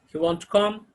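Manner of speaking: "He won't come" with "come" rising; statement as question. Shown as a demonstration. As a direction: turn it into a question by lifting "come". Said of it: "they may ask in rising tone".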